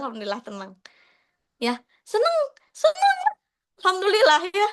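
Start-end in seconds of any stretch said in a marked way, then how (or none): 1.6-4.7 bright rising glee; happy. Real, not acted. Glad about her clear skin, rising joy. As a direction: squeal out how happy you are.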